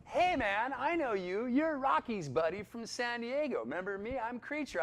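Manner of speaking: high, loud excited greeting; eager buddy. Retold or imitated. Valet Creature greeting an old buddy, high and loud. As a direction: play an overjoyed old pal spotting you.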